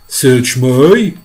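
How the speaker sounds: loud cheeky creature voice; centipede's voice. Acted. Centipede answering the grasshopper, loud character voice. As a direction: toss it back loudly in character.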